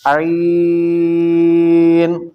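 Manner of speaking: عین long and chanted out very slowly; lengthened letter. Shown as a demonstration. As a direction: stretch the vowel of عین right out. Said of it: "اس کو لمبا کر کے پڑھنا ہے۔". (عین لمبا کھینچ کر)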